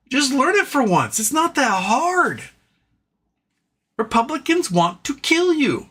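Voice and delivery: exasperated rant. Real, not acted. Talking to American voters, exasperated high rant. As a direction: berate the voters for not learning.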